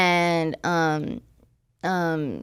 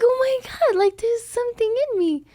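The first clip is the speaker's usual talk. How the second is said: much higher, thrilled squeal of disbelief; giddy disbelief; 13 semitones higher than usual. Retold or imitated. Young woman retelling her pregnancy test, squealing in excited disbelief. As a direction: gush like you just found out you're pregnant.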